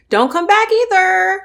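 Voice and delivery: loud, high and final; firm dismissal. Real, not acted. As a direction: shut the door on them. Telling off ex-friend directly.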